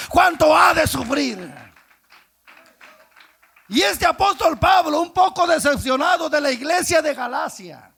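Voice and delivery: loud, high sermon delivery; fervent preaching. Acted. Preacher retelling Saul and Ananias to the church. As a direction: preach it out with fire.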